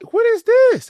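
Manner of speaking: high, loud "what is this?" in mock alarm; shocked outcry. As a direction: yelp it out, startled by the fizz. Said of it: "I was like".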